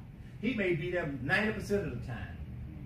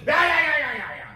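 mock shouting back; 6 semitones higher, 7 dB louder than usual. Believers cussing back at demons.

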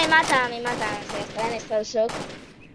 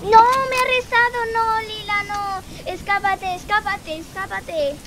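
high wail, loud then fading; dramatic wail; 7 semitones higher, 4 dB louder than usual. Real, not acted. Caught player urges Lila to escape, wailing. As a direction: cry out a desperate plea to flee.

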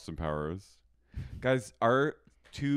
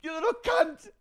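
high, loud hammed-up tantrum; mock outrage; 13 semitones higher, 4 dB louder than usual. Podcast hosts mocking a furious reality star, hammed-up shriek. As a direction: shriek it like a wounded diva.

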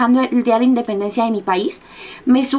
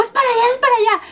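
retold urgent telling; 11 semitones higher, faster than usual. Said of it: "Y ya que les digo".

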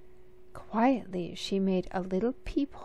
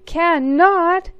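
much higher, louder Cannot; sister yells; 10 semitones higher, 12 dB louder than usual. Acted. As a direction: top him with a shrill yell, much higher. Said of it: "she yelled".

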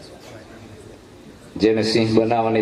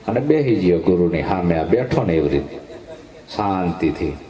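ordinary delivery

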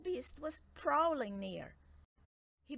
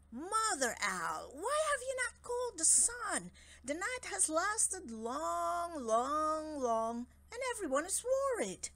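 royal lion voice; 8 semitones higher, 3 dB louder than usual. Acted. King Lion questions Mother Owl.

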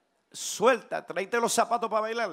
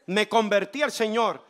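usual speaking voice

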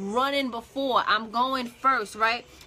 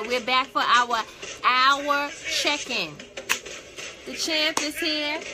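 hype host welcome; 3 semitones higher than usual. Acted. Host greeting viewers joining the live stream, upbeat announcer call. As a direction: fire up the arriving viewers like an announcer.

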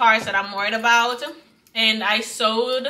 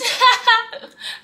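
high laugh; 13 semitones higher than usual. Real, not acted. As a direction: burst of high-pitched laughter. Scrunchie is huge.

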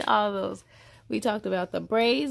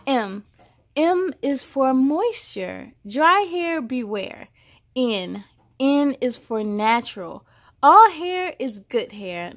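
put-on bright, measured read-aloud voice for a child; storybook reading; 6 dB louder, slower than usual. A narrator's reading. Reading a hair alphabet book aloud to a child, storybook voice. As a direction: read each line out like a picture book.

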